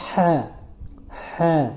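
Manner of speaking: "হ্যাঁ" squeezed from the middle of the throat; pressed throat H. Shown as a demonstration. Teacher repeating the right ha for learners, pressed in the throat. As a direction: tight pharyngeal H on "হ্যাঁ". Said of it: "এভাবে বলতে হবে".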